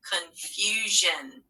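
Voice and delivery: drawn out slowly, each sound clear; slow stretched word. Shown as a demonstration. Teacher modelling a spelling word, slow and clear.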